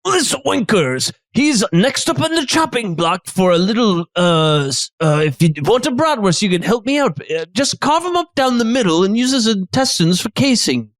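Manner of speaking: high-pitched hammy cook; chef character. Acted. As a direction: present the pig in a high showman's voice. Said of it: "the chef goes".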